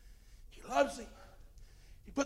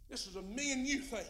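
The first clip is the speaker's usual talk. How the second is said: ordinary delivery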